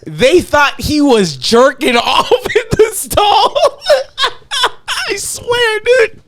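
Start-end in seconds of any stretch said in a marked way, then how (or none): 1.9-5.1 slow, laughing half-shout through the words. Real, not acted. Laughing at punchline. 5.1-6.2 high, squeaky laugh-talk. Real, not acted. Cracking up at story.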